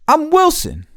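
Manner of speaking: put-on squeaky innocent kid act; goofy put-on voice. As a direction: play dumb in a high cartoonish voice. Goofing on the lunch aide.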